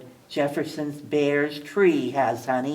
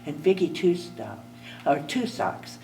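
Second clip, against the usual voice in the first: plain reading voice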